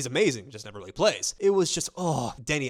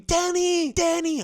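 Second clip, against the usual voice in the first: much higher, louder chant of the name; imitating fans screaming the name; 6 dB louder, slower than usual. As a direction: yell it like a superfan in the stands. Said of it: "they were just screaming".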